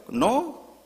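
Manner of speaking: loud, high acted "no"; angry refusal. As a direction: shut the apology down with a cry. Neighbour refuses the apology. (negativa airada y aguda)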